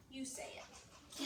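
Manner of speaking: soft whisper, slowly; whispered prompt. Real, not acted. Teacher whispers to pupils to say the word, slow and hushed. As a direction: breathe it to the class.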